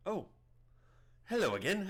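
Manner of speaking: high-pitched hermit, taken by surprise; surprised greeting in a put-on high voice; Prattis. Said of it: "I don't remember his accent".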